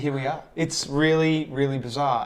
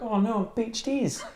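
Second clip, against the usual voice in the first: put-on Kiwi accent; 9 semitones higher, slower than usual. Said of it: "idea about this whale".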